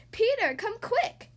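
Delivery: high, loud, slow call to her brother; shouted. A narrator's reading. Girl shouting for Peter to come see Cupid's gift. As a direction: shout for him to come running. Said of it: "I shouted".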